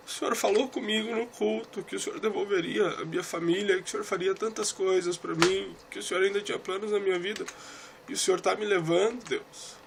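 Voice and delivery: high-pitched, pleading reproach to God; anguished pleading. Retold or imitated. Preacher reminding God of His promises, anguished high plea.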